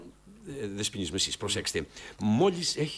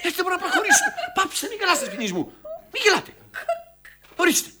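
high-pitched, raised-voice scolding. Acted. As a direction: shout down the gigglers, voice shooting up. Director silencing laughing actors.

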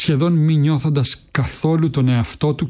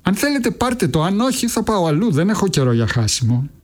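much higher, curt take-it-or-leave-it; young man cutting the old woman short, impatient; impatient Raskolnikov; 7 semitones higher than usual. A narrator's reading. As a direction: snap out an ultimatum.

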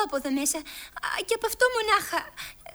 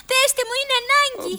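urgent plea; 7 semitones higher, 5 dB louder than usual. Acted. Alice pleads urgently.

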